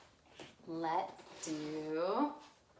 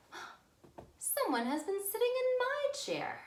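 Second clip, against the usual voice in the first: higher mother bear voice; Mama Bear voice. Acted. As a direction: lift it up into a fussy mama bear.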